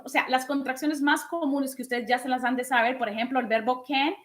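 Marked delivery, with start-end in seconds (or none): none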